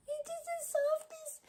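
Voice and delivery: high, soft cooing. Real, not acted. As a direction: coo to the cat. Cooing at cat.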